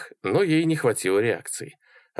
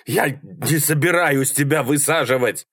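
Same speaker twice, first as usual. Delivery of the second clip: higher, strained protest; exasperated yell; 5 semitones higher than usual. A narrator's reading. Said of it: "орал Малькольм, глотая воздух". (раздражённо орать, срываясь вверх)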